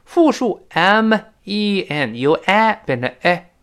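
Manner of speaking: ordinary talk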